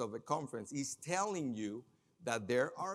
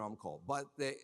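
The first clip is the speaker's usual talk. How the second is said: usual speaking voice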